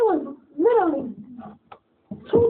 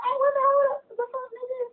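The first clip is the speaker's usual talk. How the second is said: baffled protest, pitched way up; retold indignation; 9 semitones higher than usual. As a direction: act out an offended "what the hell". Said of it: "then they get mad".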